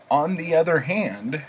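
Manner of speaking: usual speaking voice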